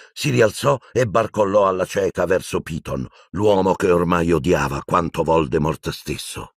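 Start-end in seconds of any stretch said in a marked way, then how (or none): none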